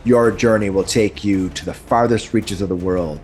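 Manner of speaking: grand fantasy narration over music; epic narration. Acted. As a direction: set the scene like a dark fantasy trailer. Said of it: "Did I oversell it?".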